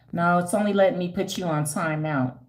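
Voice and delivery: ordinary talk, nothing marked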